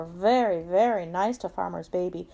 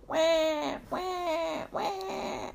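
high, drawn-out mock cries; high storybook baby wah; baby wailing imitation; 8 semitones higher, 5 dB quieter, much slower than usual. A narrator's reading. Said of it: "Farmer's baby woke up".

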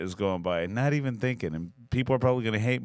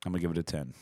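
ordinary talk

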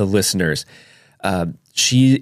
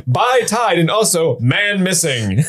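higher, slow ad-reader parody; drawn-out commercial pitch; mock commercial voice; 8 semitones higher, slower than usual. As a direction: announce it like a silly TV ad. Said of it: "commercials where, like".

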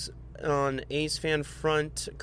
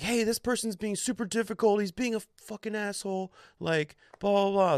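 tattling whine; 8 semitones higher than usual. Retold or imitated. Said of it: "He's like".

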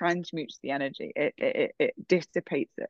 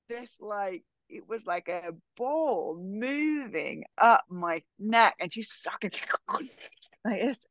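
normal talking voice

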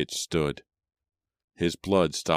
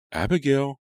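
high call, a bit louder; 10 semitones higher, 4 dB louder than usual. A narrator's reading. Mother calling her daughter in the night, higher and louder. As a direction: reach out to someone far away. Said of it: "she called a little louder".

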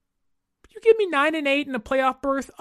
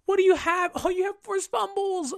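mock suitor; 9 semitones higher than usual.

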